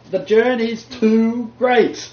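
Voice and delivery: slow, high and loud; preaching climax. Real, not acted. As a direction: lean hard on the journey's weight.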